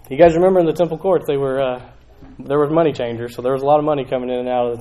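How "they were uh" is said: usual speaking voice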